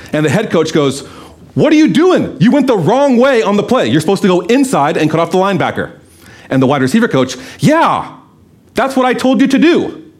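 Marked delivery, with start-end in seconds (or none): 1.6-5.9 sharp high yell, dropping by the end; angry coach yelling. Retold or imitated. Head coach yells at a receiver after a blown play, angry. 7.6-10.1 higher mock voice, siding with the boss; mocking quick agreement. Receivers coach backs the head coach, mock high agreeing. Coach covers himself, mocked.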